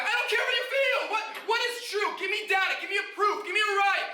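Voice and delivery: high-pitched, insistent debating voice; heated demand. Acted. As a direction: demand evidence, voice jumping up high. Acted debate, demanding proof over feelings.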